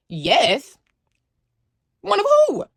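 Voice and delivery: sharp high "one of who"; indignant disbelief. Retold or imitated. Teen answering the officer's accusation, high indignant disbelief. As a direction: fire back in outraged confusion.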